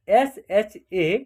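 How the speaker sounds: SHA spelled out very slowly, each letter apart; slow letter spelling. Shown as a demonstration. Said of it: "इसका उच्चारण होगा शक".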